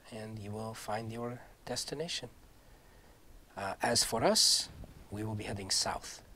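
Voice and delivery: a lightly voiced noble knight, solemn and soft. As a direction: speak as a grave, courtly knight.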